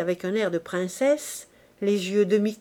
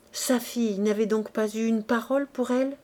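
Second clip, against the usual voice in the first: ordinary delivery, nothing marked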